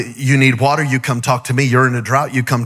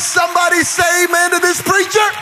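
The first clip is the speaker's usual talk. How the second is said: raised, shouting preacher's climax; full-force preaching; 7 semitones higher than usual. Acted. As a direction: build the room up and shout it out.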